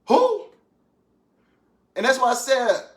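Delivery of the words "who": sudden high loud who; indignant who. Real, not acted. Defending his wife from disrespect, a high loud indignant who. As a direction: shoot the word up, indignant and loud. Rhetorical outburst mid-rant.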